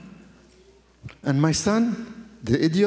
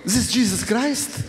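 awestruck wonder; 5 semitones higher than usual. Retold or imitated. Awestruck reliving of rescue.

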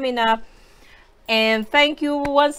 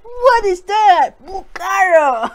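high, slow, loud outcry; shocked; 8 semitones higher, 7 dB louder, slower than usual. Real, not acted. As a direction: howl it slowly at the burn. Shocked by the spice.

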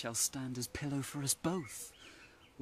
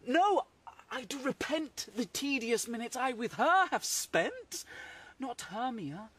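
outraged protest; 8 semitones higher than usual. Acted. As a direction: fling the words away in scorn. Lysander spurns Hermia loudly.

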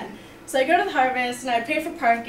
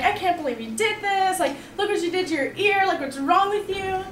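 higher-pitched mild mock yell, no real volume; mock parental scolding; 7 semitones higher than usual. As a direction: imitate appalled parents telling off their kid. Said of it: "my parents totally screamed at me".

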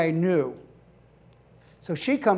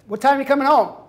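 normal talking voice

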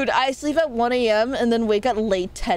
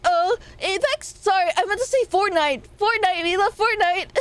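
mock innocent cover-up; 7 semitones higher than usual. Covering up Apex mention, higher.

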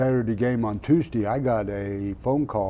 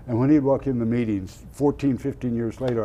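ordinary delivery

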